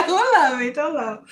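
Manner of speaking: said through laughter; laughing. Real, not acted. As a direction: laugh while saying it.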